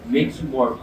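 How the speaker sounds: ordinary talk, nothing marked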